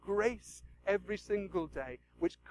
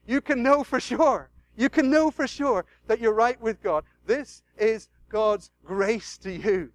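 louder, slow emphatic assurance; loud appeal; 2 semitones higher, 9 dB louder, slower than usual. Real, not acted. Preacher urging listeners, loud and emphatic. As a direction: press it home to them.

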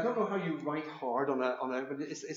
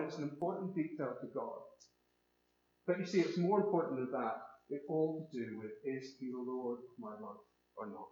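ordinary talk

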